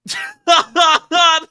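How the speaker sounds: loud, high cackling laugh; burst of laughter. Real, not acted. Watching a bomb blast on screen, loud laughing burst. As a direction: explode into laughter.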